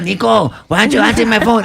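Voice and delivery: high, loud put-on voice of a pissed-off buddy; mock the needy friend's whine; imitating annoyed friend. Mocking Salo demanding why Nico won't answer, high loud imitation. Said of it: "he gets all pissed off".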